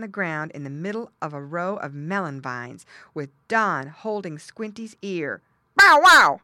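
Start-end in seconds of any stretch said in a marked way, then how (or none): 5.8-6.4 loud, high bark; barking dog. A narrator's reading. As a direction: bark sharply like a guard dog. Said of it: "barked Don".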